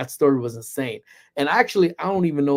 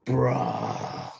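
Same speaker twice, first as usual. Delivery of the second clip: long, drawn-out exasperated groan; distressed bruh; 2 semitones lower, 4 dB quieter than usual. Shown as a demonstration. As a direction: drag the word out in weary distress. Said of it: "is in a state of distress".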